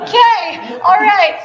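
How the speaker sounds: high, loud laugh into okay, all right; embarrassed laugh. Real, not acted. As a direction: laugh off the awkward moment. Embarrassed after awkward joke.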